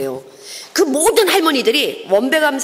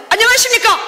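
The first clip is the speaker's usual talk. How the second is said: bright, loud door-to-door hello; hearty loud greeting to the grannies; loud cheery greeting; 13 semitones higher, 6 dB louder than usual. Retold or imitated.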